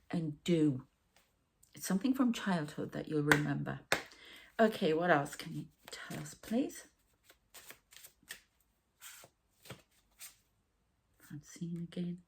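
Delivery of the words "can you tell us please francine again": soft unvoiced whisper under the breath; whispered aside. Real, not acted. Asking the spirits for more, in a whisper. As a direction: murmur a private request off to the side.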